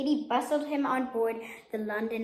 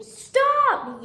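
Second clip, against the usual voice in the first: loud high shout of stop; yelled; 13 semitones higher, 10 dB louder than usual. A narrator's reading. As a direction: yell it out to stop them. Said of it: "yelled Katie".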